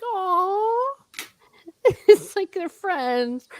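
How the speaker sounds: high, drawn-out aww with a laugh; touched cooing. Retold or imitated. Reader melting at two characters' friendship, cooing. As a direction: coo it high, giggling.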